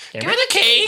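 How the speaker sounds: extremely high squawk; parrot voice. Retold or imitated. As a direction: screech it like a parrot. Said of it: "sound like a parrot".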